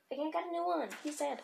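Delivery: ordinary talk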